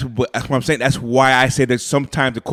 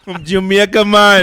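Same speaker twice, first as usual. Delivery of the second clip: loud, higher-pitched put-on Jamaican accent; 6 semitones higher, 6 dB louder, slower than usual. Joking about the island slip, mock Jamaican accent. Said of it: "There you go with a Jamaican accent again".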